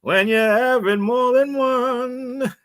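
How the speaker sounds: mock retro radio beer ad; vintage beer commercial. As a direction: croon the old beer slogan. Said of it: "Can I remember a beer".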